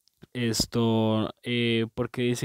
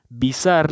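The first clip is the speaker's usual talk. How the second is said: higher and louder "Visar", falling; emphatic; 5 semitones higher, 6 dB louder than usual. Shown as a demonstration. Teacher to class, announcing "Visar" loudly. Teacher introduces example word.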